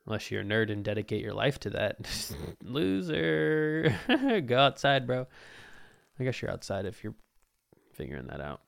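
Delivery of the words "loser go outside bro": mocking taunt. Mocking nerds.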